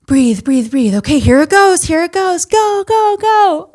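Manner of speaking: high, breathless count-in; reliving the push. Retold or imitated. Reliving the final push in the delivery room, excited and high. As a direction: breathe it in, then rise to go, go, go.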